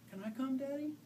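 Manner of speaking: put-on child voice. A narrator's reading. Little girl asks father.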